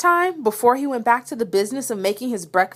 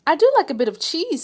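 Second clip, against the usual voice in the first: mouse voice; 7 semitones higher, faster than usual. A narrator's reading. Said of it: "said the mouse".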